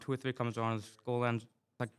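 ordinary talk, nothing marked

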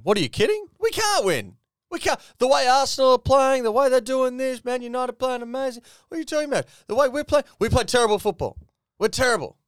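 high, loud, put-on Spanish-accented manager voice; Pep impression. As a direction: ham up a Spanish manager deflecting pressure. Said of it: "But Pep goes".

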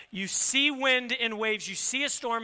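ordinary delivery

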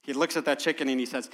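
usual speaking voice

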